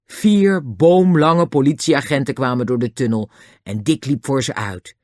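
usual speaking voice

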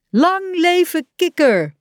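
high, slow, loud cheer; Frog's friends cheering him on; cheering shout. A narrator's reading. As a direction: hail the birthday frog with joy. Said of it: "riepen ze".

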